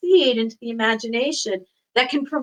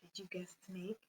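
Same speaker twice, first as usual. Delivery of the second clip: whisper; 5 semitones lower, 27 dB quieter than usual. Pretend. Teacher whispers a guessing game.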